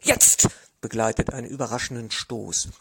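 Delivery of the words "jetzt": a sudden loud cry; loud shout. A narrator's reading. Wrestler shouting as he makes a surprise thrust. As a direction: snap it out with the attack.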